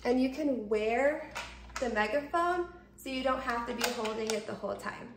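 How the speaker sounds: usual speaking voice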